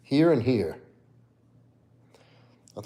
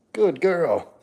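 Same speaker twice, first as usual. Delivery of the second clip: higher, sing-song praise for a pet; dog praise; 7 semitones higher than usual. Real, not acted. Owner praising Fancy after her trick, high sing-song. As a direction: reward the dog with your voice.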